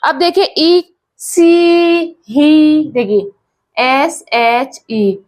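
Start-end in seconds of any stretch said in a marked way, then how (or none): 1.2-2.9 सी and ही with the ee held long; long ee sound. Shown as a demonstration. Teacher demonstrating long ई, सी and ही drawn out. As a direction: stretch the vowel in सी and ही. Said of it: "बड़ी ई से".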